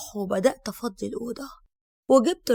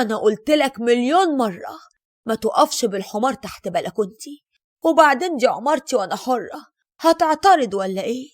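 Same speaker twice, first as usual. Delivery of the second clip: scolding; 6 dB louder than usual. Acted. Scolding the junk man loudly.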